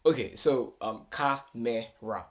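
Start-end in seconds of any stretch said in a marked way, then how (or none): 1.1-2.2 slow "camera" in three beats, ka-me-ra; broken into syllables. Shown as a demonstration. Teacher recaps three syllables, "camera" slowly split as ka-me-ra. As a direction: separate each syllable of "camera" evenly. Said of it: "three syllables".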